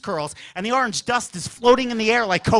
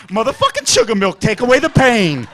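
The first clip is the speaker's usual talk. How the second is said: loud, belted plea to the drink; mock-anguished drinker's wail; mock hard drinker; 8 dB louder than usual. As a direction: cry out to the drink, hammed-up. Kid acting like a hard drinker.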